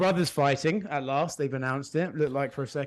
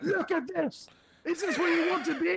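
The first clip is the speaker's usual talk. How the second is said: much higher, put-on scolding parent voice; mock nagging parent, pitched way up. Friend imitating his parents scolding him to watch TV, high put-on voice.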